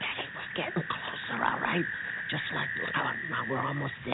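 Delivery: soft whispered warning. Acted. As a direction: keep it hushed near the dogs. Sneaking toward guard dogs.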